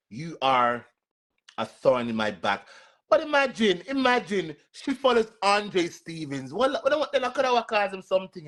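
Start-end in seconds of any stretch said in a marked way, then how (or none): 3.1-8.5 high, astonished gossip; much higher shocked voice; incredulous. Real, not acted. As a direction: act shocked at the news.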